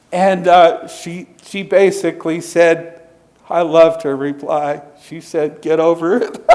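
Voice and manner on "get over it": said through a laugh; laughing quote. Retold or imitated. Marcella's reply to the table, quoted with a laugh. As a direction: toss it off while chuckling.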